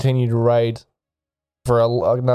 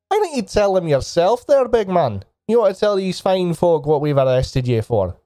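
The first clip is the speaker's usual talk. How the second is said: goading sergeant; 7 semitones higher than usual. Acted. Sergeant to the gagged orc prisoner, goading. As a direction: goad the prisoner in a raised sergeant's voice. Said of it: "rips the cloth out of his mouth and goes".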